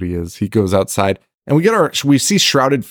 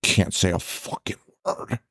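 softer, urgent hushed order; mock hushed warning; 9 dB quieter than usual. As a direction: shush them in a tense undertone. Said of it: "it was like".

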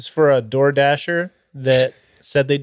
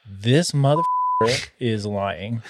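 slow, drawn-out mock indignation; retold disbelief; 3 semitones lower, 4 dB quieter, slower than usual. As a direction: drag out each word in retold disbelief. Retells outrage at lying delivery driver.

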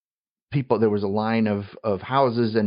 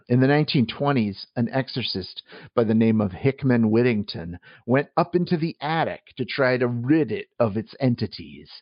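normal talking voice

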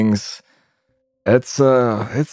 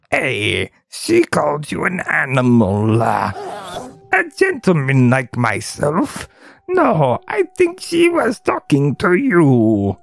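goblin character voice; 2 semitones higher than usual. Acted. Goblin boasting about the captive girl, hammed-up character voice. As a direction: play a menacing, bragging goblin. Goblin figures in game read aloud.

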